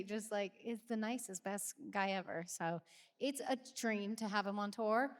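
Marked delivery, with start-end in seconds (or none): none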